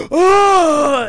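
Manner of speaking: loud high-pitched mock scream; gasp, then shriek in comic horror; hammed-up terrified cry; horrified gasp and scream. Imitates skinhead's horrified reaction on looking.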